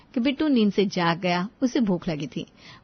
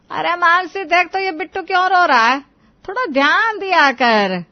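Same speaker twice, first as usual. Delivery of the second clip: raised, loud mother-in-law's complaint; scolding call; 9 semitones higher, 8 dB louder than usual. Acted. Mother-in-law calls daughter-in-law to the crying baby, loud scolding. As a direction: call out higher and louder, chiding her.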